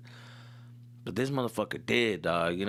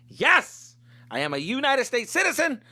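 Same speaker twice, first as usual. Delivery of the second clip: high, loud proud declaration; defiant shout; 11 semitones higher, 6 dB louder than usual. Real, not acted. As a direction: fire back at the doubters. Defiant answer to doubters.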